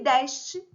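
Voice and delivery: "deste" with an open E, "DÉS-te", loud. Shown as a demonstration. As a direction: say "deste" with a wide é. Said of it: "Pronúncias diferentes".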